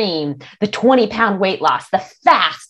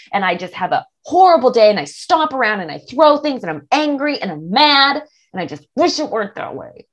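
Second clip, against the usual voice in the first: ordinary talk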